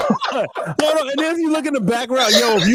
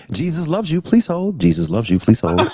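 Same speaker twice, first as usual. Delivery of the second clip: call center voice; 7 semitones lower, faster than usual. Retold or imitated. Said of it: "the, uh, call center?".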